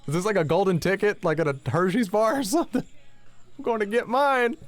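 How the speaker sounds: mock excitement. Mocking golden ticket joke.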